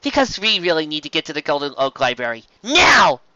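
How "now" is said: impatient command. A narrator's reading. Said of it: "Twilight said".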